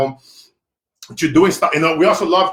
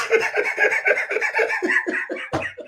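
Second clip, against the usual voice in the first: high laugh; 4 dB quieter than usual. Real, not acted. Laughs at own joke.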